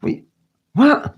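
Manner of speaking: high, rising disbelieving "what"; shocked reaction, pitched sharply up; retold shock. Vlogger re-enacts being refused the figures, shocked. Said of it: "you're, like, shocked".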